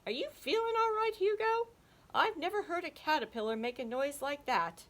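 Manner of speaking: a worried high spider asking. Acted. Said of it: "Ollie frowned".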